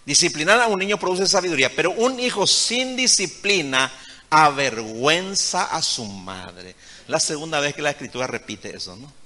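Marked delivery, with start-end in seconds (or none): 0.1-6.7 louder, higher, driving the verse; preaching loud. Real, not acted. Preacher proclaiming the proverb to mothers. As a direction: proclaim it to the congregation.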